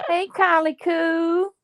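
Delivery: high, slow playful hello; sing-song greeting. Pretend. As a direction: call out teasingly slow. Mock greeting friends seen live.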